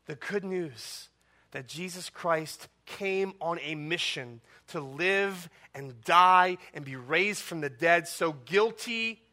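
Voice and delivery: ordinary talk, nothing marked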